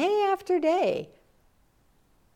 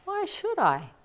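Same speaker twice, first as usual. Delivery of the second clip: higher, put-on lazy reply; lazy retort; 12 semitones higher than usual. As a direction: answer like you can't be bothered. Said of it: "And Ganto".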